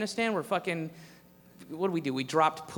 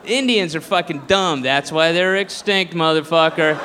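ordinary delivery

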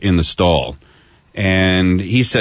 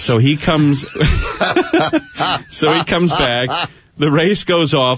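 laughing through the words, a bit higher; 5 semitones higher, slower than usual. Real, not acted. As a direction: chuckle as you tell it. Laughing while telling the story.

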